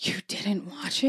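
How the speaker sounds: quiet, incredulous question; feigned disbelief. Pretend. Fan questioning a friend who missed the fight, feigned disbelief. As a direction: play the die-hard fan, softly taken aback. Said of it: "pretend like you are a diehard sports fan".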